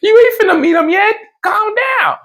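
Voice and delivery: high, fast teasing voice; high, quick mock reassurance. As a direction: tease her high and fast. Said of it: "I'm like".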